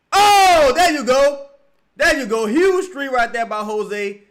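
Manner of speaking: loud, high cheer for the shot; real excited cheer. Real, not acted. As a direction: celebrate the three at full voice. Big three made.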